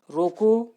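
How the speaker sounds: रोको set apart, high and loud; stop after रोको. Shown as a demonstration. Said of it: "यहाँ पे विराम का चिन्ह है". (रोको ऊँचे ज़ोर से, फिर विराम)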